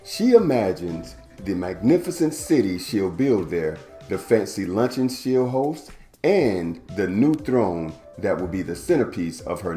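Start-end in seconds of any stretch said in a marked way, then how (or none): none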